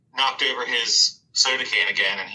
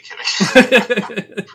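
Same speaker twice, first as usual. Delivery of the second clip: laughing through the words, slowly; 11 semitones higher, 8 dB louder than usual. Acted. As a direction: chuckle it out, half joking. Joking about fighting geckos.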